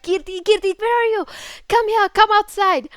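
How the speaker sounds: high urgent call across the yard; calling out to a friend. Retold or imitated. Calling to friend Kirti from behind the gate, high urgent call. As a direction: call her name to come outside, high and pressing.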